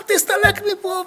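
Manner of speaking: high-pitched order to get out; mock angry shout. Rabbi acts out chasing the man away, with a shout. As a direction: throw him out with a cry. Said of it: "זה צועק עליו".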